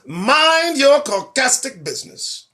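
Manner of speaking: high, slow, drawn-out retort; indignant comeback. Acted. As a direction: stretch it out as a pointed telling-off. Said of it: "that's how you answer".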